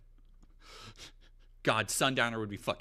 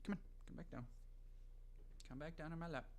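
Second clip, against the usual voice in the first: much softer, gentle coax to the cat; 5 semitones higher, 16 dB quieter, faster than usual. Real, not acted. Streamer coaxing the cat onto the lap, soft and gentle. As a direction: softly coax her back down.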